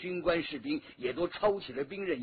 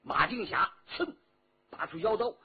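ordinary delivery, nothing marked

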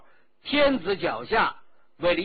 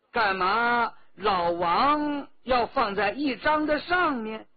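ordinary delivery

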